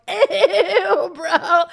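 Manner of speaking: high, loud laughing "ew, bro". Retold or imitated. Crowd mocking a stripper on stage, laughing disgust. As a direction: squeal it through laughter, grossed out.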